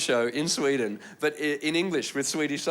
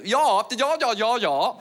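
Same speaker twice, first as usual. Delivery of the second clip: high mock-English gibberish; high, nonsense yapping; imitated English woman; 7 semitones higher, 3 dB louder, much slower than usual. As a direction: yap it as a foreigner hears English. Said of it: "this English woman saying".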